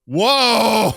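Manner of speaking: loud high whoa; surprised whoa. Retold or imitated. Jill hears the dark song, loud shocked whoa. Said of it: "Jill goes".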